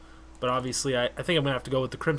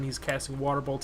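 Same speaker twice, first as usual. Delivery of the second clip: ordinary delivery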